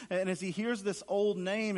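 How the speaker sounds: ordinary delivery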